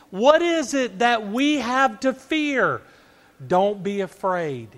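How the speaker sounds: high, urgent questions, mostly loud; impassioned preaching. Acted. Preacher asks believers what they have to fear, preaching urgently.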